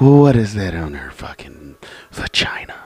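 whispered in disbelief; shocked whisper. Real, not acted. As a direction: hush it out, appalled. Shocked at photos on screen.

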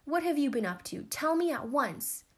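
high, loud, insistent demand; cross snapping. A narrator's reading. Ancient half-sister interrogating her little sister, sharp snapping. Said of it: "snapped the ancient one".

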